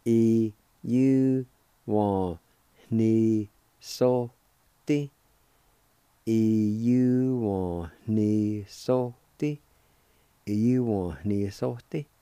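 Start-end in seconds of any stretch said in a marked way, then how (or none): 0.0-5.1 E U, N, I S O, T spoken as separate louder chunks; syllable by syllable. Shown as a demonstration. Teacher sounding out each syllable for students, slowly. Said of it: "it's pronounced like this". 6.2-9.6 E U one ni so ti said very slowly, every syllable held; slow it right down so students can follow; slow pronunciation. Shown as a demonstration. Teacher modelling the whole word for students, very slowly. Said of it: "it's pronounced like this".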